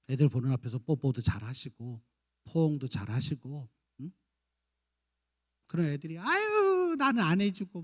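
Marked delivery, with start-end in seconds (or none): 6.2-7.8 high, drawn-out mock whine; whiny envy. 아이들이 부러워하는 흉내. (부러워 투정하는 말투)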